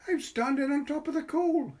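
put-on high, loud child's voice, proud of himself; little boy showing off on the coal heap. A narrator's reading. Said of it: "he said".

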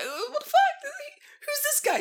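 very high excited dog voice; dog's voice. Retold or imitated. Voicing the happy dog greeting a guest, high and excited. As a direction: squeal it like a thrilled little dog. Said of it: "he was like".

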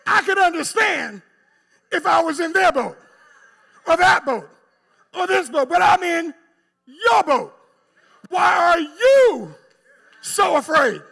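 high, shouted sermon climax; full-force preaching. Acted. Jesus challenging his disciples in the boat, shouted preaching. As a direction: shout it high, stretching each "why are you so afraid".

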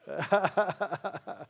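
rapid, breaking run of ha-ha laughter; amused chuckle. Real, not acted. Preacher tickled that John chuckled, laughing. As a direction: chuckle helplessly at your own joke.

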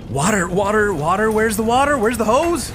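high, frantic questions; searching in a panic. Acted.

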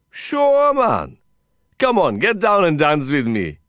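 put-on high, emphatic Indian-accented urging; eager coaxing. Cowboy friend urging Lin to dance, eager and high. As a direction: urge him on, starting very high.

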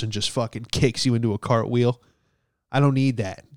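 ordinary talk

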